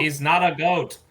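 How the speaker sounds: high, very loud protest; indignant shout. Acted. Correcting the shopkeeper about the goat, indignant shout.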